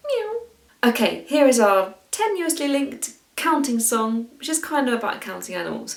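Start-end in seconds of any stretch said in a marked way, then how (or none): 0.1-0.4 short meow much higher up; tiny kitten mew; high squeaky meow. Shown as a demonstration. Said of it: "meow like a cat".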